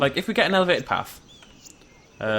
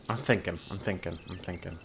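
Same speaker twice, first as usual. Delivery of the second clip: much lower and softer, muttering; muttered thinking; 7 semitones lower, 10 dB quieter than usual. Real, not acted. Planning buildings, muttering low to himself. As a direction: murmur it to yourself.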